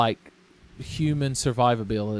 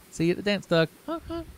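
goofy high clown voice; 10 semitones higher than usual. Pretend.

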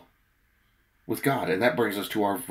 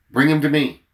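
loud, raised-pitch order read from scripture; commanding; 7 semitones higher, 12 dB louder than usual. A narrator's reading. Jesus orders the boy brought to him, loud command. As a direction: call it out as a firm command.

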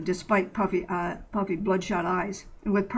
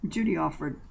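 usual speaking voice

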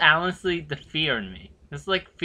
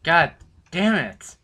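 frustrated groan; 4 semitones higher, 6 dB louder, much slower than usual. Real, not acted. Frustrated drawn-out curse.

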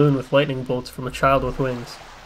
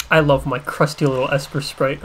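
normal talking voice

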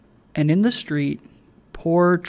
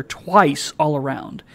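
ordinary delivery

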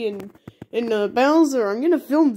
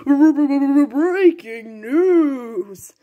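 very drawn-out TV news announcement; news announcer; 2 semitones higher, 3 dB louder, much slower than usual. Acted. TV announcer interrupts with a bulletin, drawn-out mock newscaster.

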